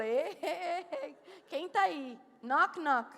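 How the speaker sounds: loud teasing "anyone there?" with a laugh; mock knocking call. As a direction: knock on the door of a fake prayer voice. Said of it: "eu falo".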